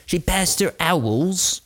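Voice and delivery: lightly mock her like a Hogwarts pupil; slow, high teasing voice; mock schoolgirl. Hogwarts joke about the teen.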